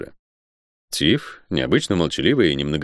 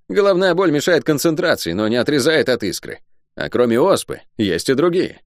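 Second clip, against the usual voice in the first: higher-pitched, indignant rebuttal; 7 semitones higher than usual. A narrator's reading. Шен challenging her headache excuse, indignant. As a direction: object with a raised pitch. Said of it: "возмутился Шен".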